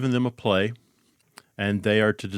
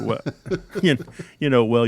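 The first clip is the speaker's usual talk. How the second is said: ordinary talk, nothing marked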